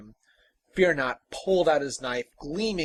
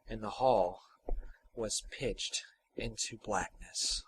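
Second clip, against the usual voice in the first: hushed story narration. A narrator's reading. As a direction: let the story sink into darkness, hushed. Hushed narration after the fight.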